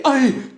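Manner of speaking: loud, high 'ay' as if hit; exaggerated high ouch; mock cry of alarm. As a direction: yelp like a wounded ego. Mimics megalomaniac feeling attacked.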